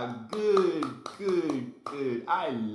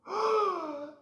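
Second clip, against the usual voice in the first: excited gasp. Pretend. Excited discovery.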